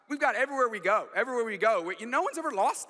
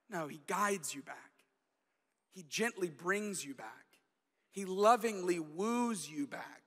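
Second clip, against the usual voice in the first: gentle reassurance; 2 semitones lower, 5 dB quieter than usual. Real, not acted. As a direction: gently reassure the congregation, quieting right down. Preacher reassures of God's gentleness.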